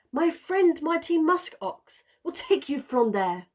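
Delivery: high, loud proud announcement; pompous walrus. A narrator's reading. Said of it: "proclaimed with his chin".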